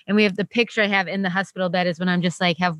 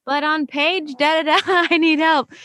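pleading; 9 semitones higher than usual. Retold or imitated. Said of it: "And I was like".

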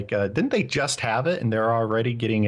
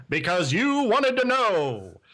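newsreel announcer; 8 semitones higher, slower than usual. Pretend. Mock newsreel sign-off.

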